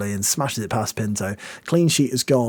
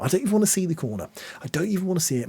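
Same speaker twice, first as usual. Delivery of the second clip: fast nervous; 6 semitones higher, 4 dB quieter, much faster than usual. Real, not acted.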